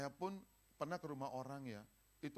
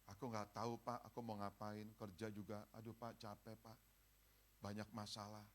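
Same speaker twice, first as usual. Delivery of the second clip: tired, much softer sighing complaint; a quiet, drained moan to the pastor; weary complaint; 3 semitones lower, 7 dB quieter than usual. Retold or imitated. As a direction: sound worn out and lost. Voicing a weary, lost believer.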